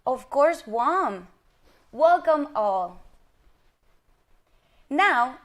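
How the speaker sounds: ordinary delivery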